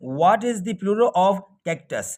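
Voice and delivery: very slow, measured question; teacher dictating a quiz question very slowly; slow question read. Shown as a demonstration. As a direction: spell the question out slowly for students.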